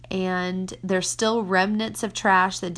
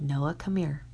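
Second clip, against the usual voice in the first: hushed, calling him over; a quiet come-here to a child; soft and low, not scolding; 5 semitones lower, 5 dB quieter than usual. Retold or imitated. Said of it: "in a very whisper tone".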